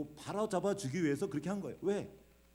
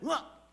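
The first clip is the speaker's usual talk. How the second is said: mock angry yell; high, loud pretend roar; 13 semitones higher, 5 dB louder, slower than usual. Imagined shout at Cain.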